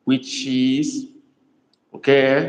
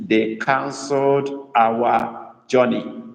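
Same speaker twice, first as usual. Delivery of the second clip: slow dictation; slower than usual. Shown as a demonstration. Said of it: "And the sentence is".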